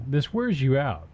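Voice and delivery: ordinary talk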